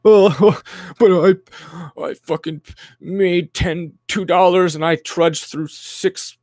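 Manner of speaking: high, slow whiny mock of an old-timer; mocking older person. Streamer mocking a boomer bragging about hard work, whiny mock voice. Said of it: "and then you're like".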